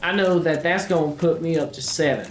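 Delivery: a country-boy Southern accent played for laughs; put-on Southern accent. Gamer chatting to viewers in a mild mock Southern accent. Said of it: "I feel like I should be offended by this accent".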